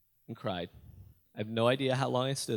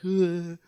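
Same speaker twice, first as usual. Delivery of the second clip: anguished groan; 6 semitones higher, 5 dB louder than usual. Retold or imitated. Crying, face against the door.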